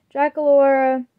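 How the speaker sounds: plain reading voice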